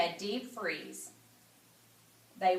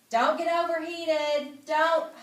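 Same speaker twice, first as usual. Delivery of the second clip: fussing mother; 6 semitones higher, 6 dB louder than usual. Acted. Mother warning the kids.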